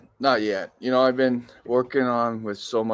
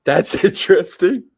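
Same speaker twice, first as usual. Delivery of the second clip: laughing sarcastic; 6 semitones higher, 6 dB louder than usual. Pretend. Mock reaction to volcano news.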